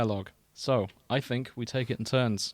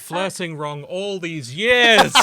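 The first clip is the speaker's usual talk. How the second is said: mock shock; 5 semitones higher, 9 dB louder, slower than usual. Mock shock at being told off.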